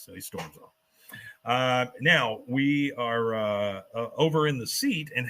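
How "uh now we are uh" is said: normal talking voice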